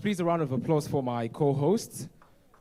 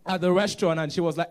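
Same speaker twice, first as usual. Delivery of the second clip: ordinary talk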